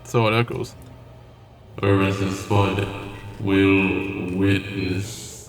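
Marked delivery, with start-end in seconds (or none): 1.8-5.5 low, very slow monster voice. Pretend. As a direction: drag it out like a creature. Voicing the monster's call.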